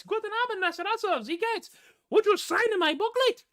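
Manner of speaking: put-on a starstruck fan in a Bavarian accent; breathless high fanboy squeal; gushing fanboy. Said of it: "I fanboyed all over the place".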